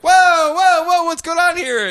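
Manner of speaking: high, loud alarmed whoa; startled whoa. Acted. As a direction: pull up short in alarm. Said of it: "they're like".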